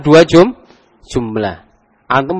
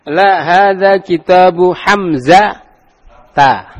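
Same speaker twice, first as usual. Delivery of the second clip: slow, drawn-out Arabic recitation; slow teacher demo; slow model reading; 3 semitones higher, slower than usual. Shown as a demonstration. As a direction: recite slowly for the class to repeat.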